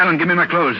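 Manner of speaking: urgent order. Acted. Patient insists on getting up.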